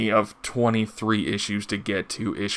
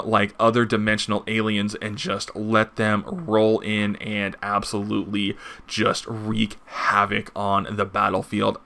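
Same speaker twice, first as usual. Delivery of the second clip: ordinary talk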